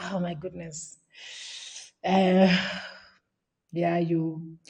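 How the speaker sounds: breath catches, words almost gone; overcome, barely getting it out; choked up. Real, not acted. Host overwhelmed by the coach's answer, choked up. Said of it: "you are making me emotional".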